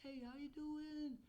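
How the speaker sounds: very high, drawn-out cheery greeting; slow, pitched-way-up chirp. Shown as a demonstration. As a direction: squeal the hello in an excited AI voice. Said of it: "it's gonna give this high voice".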